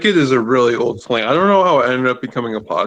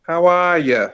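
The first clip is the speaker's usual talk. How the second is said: southern greeting; 5 semitones higher than usual. Shown as a demonstration. Offering a southern greeting on request.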